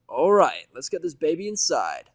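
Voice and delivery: high, loud upbeat mare; directing. Acted. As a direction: call it out brightly. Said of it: "Vinyl directs".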